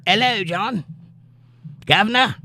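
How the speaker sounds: high-pitched mock Cockney greeting; British "guv'nor" accent. Host reading Amy's hello to John aloud, mock British accent. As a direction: put on a playful British accent, 'ello and guv'nor. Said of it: "you could type in an accent".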